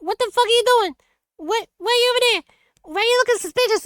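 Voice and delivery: high, fast mock interrogation; hammed-up nosy neighbour, high and quick; imitating suspicious neighbour. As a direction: rattle it off high like a nosy resident. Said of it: "they'll be like".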